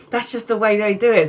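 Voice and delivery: repeating the line through a laugh; amused laughing echo. Real, not acted. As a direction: laugh while you say it again. Repeats cousin's words laughing.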